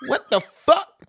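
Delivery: loud, high outraged disbelief; shocked at the deductions, loud and high. Real, not acted. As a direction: shout it at the paycheck.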